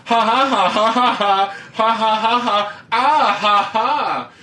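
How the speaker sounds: mocking laugh. Reading mocking ha ha aloud.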